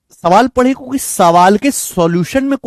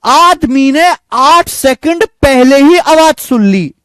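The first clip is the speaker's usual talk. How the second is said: higher and louder, punching the point home; excited reveal; 6 semitones higher, 5 dB louder, slower than usual. Real, not acted. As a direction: announce the answer with triumph. Teacher repeats the key point. (जोश से ऊँची और तेज़ आवाज़ में)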